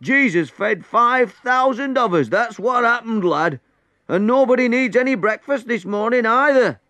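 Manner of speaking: loud, high, excited announcement; high, exultant holler; triumphant shouting. A narrator's reading. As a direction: proclaim the big news across the water. Man shouts triumphant news to boats.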